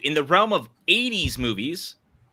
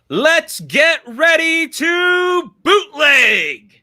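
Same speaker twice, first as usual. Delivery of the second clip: drawn-out ring announcer call; announcer shout; 10 semitones higher, 5 dB louder, much slower than usual. Acted. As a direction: boom it like a fight announcer. Ring announcer style intro.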